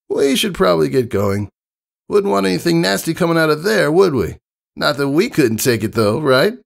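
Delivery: high, boastful and fast at the end; brash tomboy pegasus. Acted. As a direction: show off playfully to the kid. Narrator voices Rainbow Dash.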